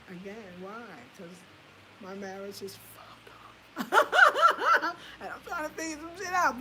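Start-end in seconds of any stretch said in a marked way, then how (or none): 2.0-3.3 whispered confession; whispering the real reason. Real, not acted. 3.9-6.6 high laughing voice; real laughter spilling into words. Real, not acted. Laughs after confession.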